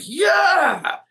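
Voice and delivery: very high whooping cheer; retold yell. Friend at the back of the green cheering the putt, high whoop. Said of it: "And he just yells".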